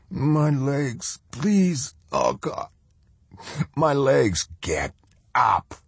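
high, strained cry of a wounded man; begging through the pain in his legs; pained pleading. Acted. Wounded prisoner in agony.

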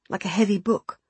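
ordinary delivery

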